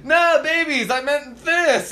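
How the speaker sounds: squeaky, flustered high voice; high-pitched flustered cartoon guy; high mock cartoon voice. Cartoon guy apologizing to crying babies, high and squeaky. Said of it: "they're like".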